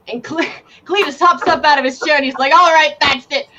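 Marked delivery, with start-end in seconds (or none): 2.5-3.5 loud, higher fed-up snap; fed-up threat. Acted. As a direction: lose it and call initiative. GM snaps, calls initiative.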